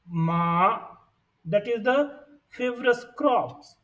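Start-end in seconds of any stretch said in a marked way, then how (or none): 0.1-0.8 "ma" with a rising tone; second tone. Shown as a demonstration. Teacher shows second tone, "ma" rising. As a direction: lift the pitch through "ma". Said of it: "The second tone".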